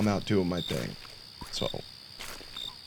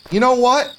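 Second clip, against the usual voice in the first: sudden burst; 13 semitones higher, 5 dB louder than usual. Real, not acted. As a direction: voice jumps high mid-sentence. Sudden resolve, very high.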